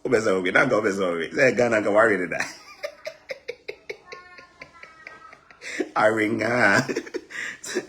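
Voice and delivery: loud, laughing retelling; loud laughing storytelling; laughing through story. Real, not acted. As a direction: crack up while telling it.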